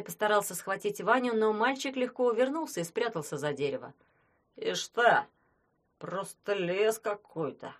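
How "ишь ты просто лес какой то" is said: put-on slow drunken grumble; grumbling drunk. A narrator's reading. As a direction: grumble it, lost and tipsy. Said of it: "проворчал пьяный".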